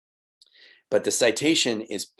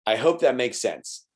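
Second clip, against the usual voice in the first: playful repeat; 4 semitones higher than usual. Shown as a demonstration. Teacher teasing students who heard it already, playful. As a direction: toss it off with a knowing grin in the voice.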